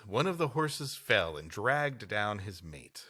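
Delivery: ordinary talk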